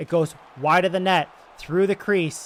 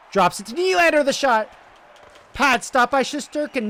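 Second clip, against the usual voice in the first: excited chance call; 8 semitones higher, 4 dB louder than usual. Acted. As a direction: pitch jumps high and loud on the pass. Scoring chance in play-by-play.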